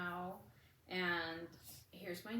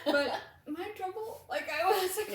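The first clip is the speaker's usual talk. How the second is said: laughing; 11 semitones higher, 8 dB louder than usual. Real, not acted. Laughing panic about trouble.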